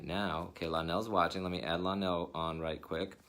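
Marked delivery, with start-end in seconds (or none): none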